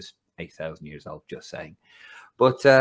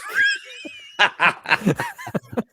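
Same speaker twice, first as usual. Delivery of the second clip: a high, loud burst of laughter; shrieking laugh; 4 dB louder than usual. Real, not acted. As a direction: laugh out loud in real shock. Reacting to being in strip bar.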